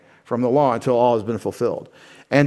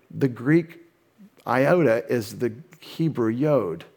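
slow careful naming; 3 semitones lower, 4 dB quieter, slower than usual. Shown as a demonstration. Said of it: "in Hebrew".

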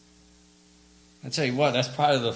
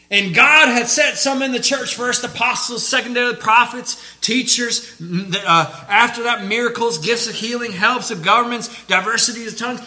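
loud, raised scripture reading; full-force preaching; 8 semitones higher, 11 dB louder than usual. Real, not acted. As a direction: belt out the list to the congregation.

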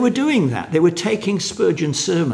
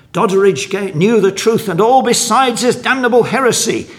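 higher, louder quoted outrage; indignant denunciation; 8 semitones higher, 6 dB louder than usual. Retold or imitated. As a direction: denounce him as a heretic, emphatically. Quoting an indignant critic of Doddridge.

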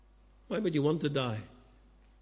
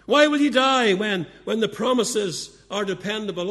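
higher and louder, driving the question home; preaching shout; 4 semitones higher, 7 dB louder than usual. Real, not acted. Preacher to unsaved listeners, loud pleading cry. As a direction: throw the question out to the whole hall.

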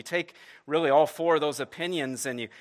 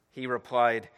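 ordinary talk, nothing marked

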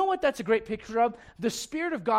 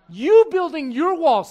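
loud, slow, emphatic preaching; full-force preaching; 9 semitones higher, 15 dB louder, slower than usual. Real, not acted. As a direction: drive the point home from the pulpit.

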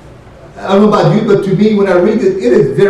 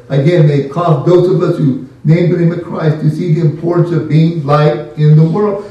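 ordinary delivery

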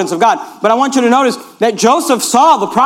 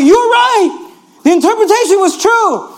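excited butler; 9 semitones higher than usual. Retold or imitated. Imagined butler, thrilled to be freed.

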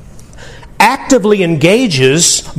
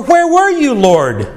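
high anguished question, falling at the end; venting cry; 8 semitones higher, slower than usual. Retold or imitated. Believer venting pain to God, high anguished cry. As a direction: pour the hurt into the question. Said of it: "when you stand and say".

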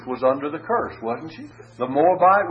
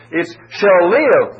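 slow, louder "shall live"; stress on shall live; 4 semitones higher, 7 dB louder, slower than usual. Shown as a demonstration. Preacher contrasting Paul's letters, emphatic. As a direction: lean into "live". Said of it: "says it's the just we're concerned about".

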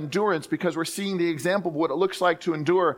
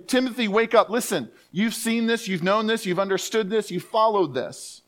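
urgent Paul; 6 semitones higher than usual. Retold or imitated. Paul urges Timothy to remember, rousing. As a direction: rouse a sleepy student. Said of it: "It's meant to say".

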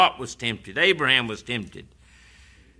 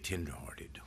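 soft, lower tenderhearted; hushed tenderhearted; 9 semitones lower, 16 dB quieter than usual. Acted. Repeated, hushed.

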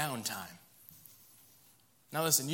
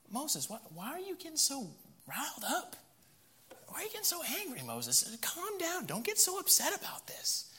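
high, soft, placating whine; whiny excuse-making Aaron; 9 semitones higher, 6 dB quieter than usual. Acted. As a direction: talk Moses down like a pleading child. Aaron soothing angry Moses.

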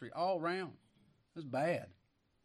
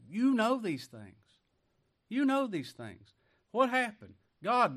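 higher and louder, insistent on "you know these things"; raised insistence; 7 semitones higher, 7 dB louder than usual. Real, not acted. Preacher pressing listeners who know scripture, raised and insistent. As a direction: insist they already know.

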